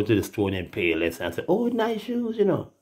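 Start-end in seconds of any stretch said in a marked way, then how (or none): 1.5-2.7 high, gushing imitation; mock admiring shopper. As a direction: coo over the shoes in a high voice. Mimicking shoppers at Payless.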